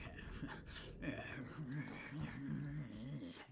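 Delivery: long, put-on chuckling noise; drawn-out mock laugh; movie character's laugh. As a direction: imitate the guy from Jaws chuckling. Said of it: "that guy from Jaws that looks like Jay Schlatt will be like".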